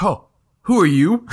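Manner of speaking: high, loud, startled question. Acted. Boy startled by another kid climbing through the window, surprised. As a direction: caught off guard by an intruder. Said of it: "he's like".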